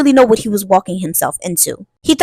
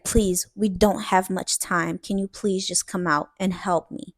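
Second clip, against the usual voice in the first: softer, slow pleading; quiet urgent plea; 2 semitones lower, 9 dB quieter than usual. Retold or imitated. Priestess pleading softly and slowly with the hidden helper. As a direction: beg them quietly, slowly, to step forward. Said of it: "And she says again".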